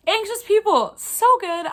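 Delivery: high, loud burst of delight; gushing. Real, not acted. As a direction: blurt the title out in pure excitement. Gushing over favourite book.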